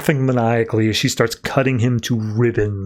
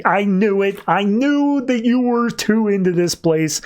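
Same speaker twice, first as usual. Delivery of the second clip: high-pitched exasperated accusation; retold exasperation, pitch climbing well up; 8 semitones higher than usual. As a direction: act out fed-up blame in a raised pitch. Acted exasperated accusation at teammate.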